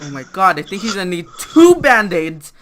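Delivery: loud, comic alarm; mock alarm. Pooh seeing the squirting blood, loudly alarmed. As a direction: burst out in exaggerated panic.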